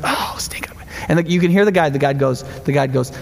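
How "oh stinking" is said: hushed frustrated whisper from the hunter. Retold or imitated. Hunter whispering as the big buck turns to leave. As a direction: breathe it out like a hunter afraid to spook the deer. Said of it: "the hunter goes".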